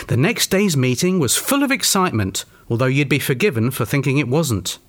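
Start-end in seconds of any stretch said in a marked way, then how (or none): none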